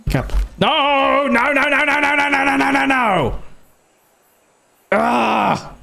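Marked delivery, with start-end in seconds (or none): 0.6-3.4 very high, rapid-fire wail of dismay; one long squealed protest; panicked wail. Real, not acted. Gamer watching things go wrong in game, panicked wail. 4.9-5.7 high cry of frustration; short exasperated yell; frustrated scream. Real, not acted. Gamer after a disaster in game, frustrated scream.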